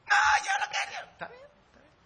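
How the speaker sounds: put-on high, wailing child's cry; bawling child giving up. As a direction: sob and give in like a little girl. Child crying shout.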